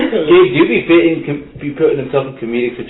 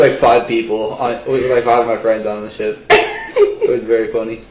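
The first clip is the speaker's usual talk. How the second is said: ordinary talk, nothing marked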